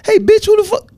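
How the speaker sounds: half-joking imitation of a brash waitress; aggressive waitress. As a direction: fire it off like a rude waitress. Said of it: "it was aggressive as fuck".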